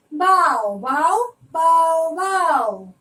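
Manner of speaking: loud playful bow-wow; dog barking. Shown as a demonstration. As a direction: bark like a dog for kids. Barking in poem chant.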